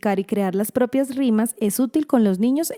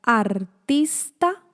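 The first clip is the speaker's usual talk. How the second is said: "artista" split as ar, tis, ta; syllable by syllable; much slower than usual. Shown as a demonstration. Teacher asking for a syllable count, "artista" split ar, tis, ta. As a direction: pause between the beats of "artista": ar... tis... ta. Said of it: "Cuántas sílabas hay".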